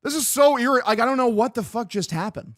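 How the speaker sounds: high, loud frustrated rant. Real, not acted. Streamer can't get the game back, frustrated loud rant. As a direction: let the irritation boil over. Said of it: "I'm so fucking frustrated".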